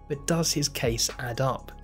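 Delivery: ordinary talk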